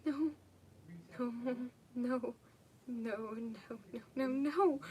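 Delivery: slow, hammed-up crying no's; mock crying. Reader redoing the scene as mock crying no's. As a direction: fake a sob, rising at the end. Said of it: "oh, wait, I gotta cry".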